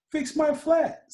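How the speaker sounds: put-on high, slow little kid asking; a child asking a friend for a favour, high and slow; Murray asking for help. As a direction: plead it like a small boy. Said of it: "said Murray".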